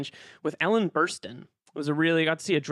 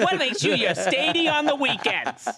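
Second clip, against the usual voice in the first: put-on Boston accent; 7 semitones higher, 3 dB louder than usual. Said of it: "do a Boston accent for it".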